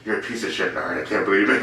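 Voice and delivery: low, soft mock murmur; hushed audience muttering. Audience members reacting to the comic bombing, imitated low muttering. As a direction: keep it down, imitating a disapproving onlooker. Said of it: "having their mouth".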